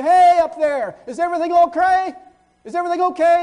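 high, loud call to someone above. Acted. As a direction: shout up the slope to check on him. Calling up to a man on a slope.

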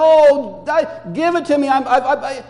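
loud, high imitated whining; mock crying whine. Esau crying over what he lost, mock whining. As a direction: whine like a crybaby, hammed up. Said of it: "crying and crying and whining and saying".

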